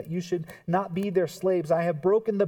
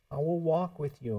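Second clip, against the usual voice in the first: normal talking voice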